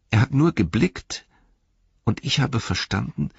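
high-voiced, shaken hobbit recounting a vision; Pippin. A narrator's reading. (aufgewühlter Hobbit, hohe Stimme)

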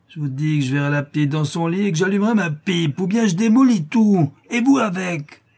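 high, loud, building to a yell; rowdy, violent bellowing; enraged threat. A narrator's reading. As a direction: rant and climb to a shout. Drunk threatens to wreck everything.

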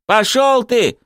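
high, angry defiant cry; shouted retort. A narrator's reading. Zagir brushes off his accuser, shouting high. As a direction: hurl it back, voice pitched high. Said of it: "крикнул в ответ Загир".

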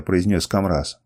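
ordinary delivery

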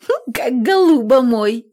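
a giggle through the words; giggling. Acted. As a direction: giggle it out fondly. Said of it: "хихикнула Лукаша". (хихикая)

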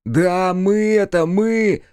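high, loud, drawn-out announcement; stretched, high-pitched holler; calling out. A narrator's reading. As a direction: sing out impatiently to those inside. Степ announces himself, climbing in.

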